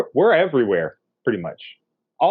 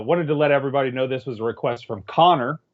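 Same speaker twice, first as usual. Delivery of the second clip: normal talking voice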